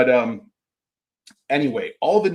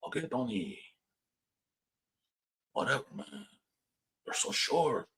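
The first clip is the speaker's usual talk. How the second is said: soft, put-on gangster voice; hushed, dismissive mob character; 10 dB quieter than usual. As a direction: brush off a co-star quietly. Other gangster answers Tony in skit.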